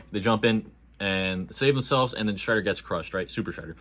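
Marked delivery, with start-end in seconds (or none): none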